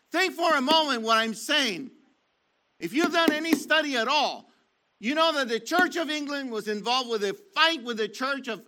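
fiery sermon emphasis rising on church; preaching. Acted. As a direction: preach it hard at the congregation.

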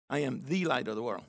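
"the" set apart and stressed before light. Shown as a demonstration. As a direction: lean on "the", as the one and only light. Restates quote to show emphasis.

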